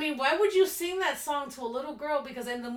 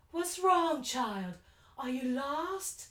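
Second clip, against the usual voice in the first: put-on creepy old man drawing out his questions; slow Southern-tinged creepy preacher; Reverend Kane; 3 semitones higher, slower than usual. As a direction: coax a lost child, slow and eerie. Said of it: "he's so creepy".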